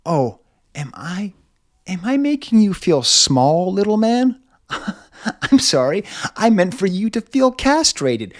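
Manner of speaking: high, loud, gloating mockery; mock taunting diva. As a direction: sneer it down at a little man. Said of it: "in a way of being like".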